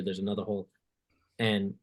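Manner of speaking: ordinary delivery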